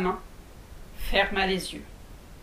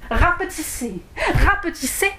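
rising as she shrinks; 8 semitones higher, 4 dB louder than usual. A narrator's reading. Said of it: "repeated rapetisser".

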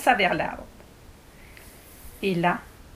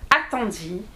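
ordinary delivery

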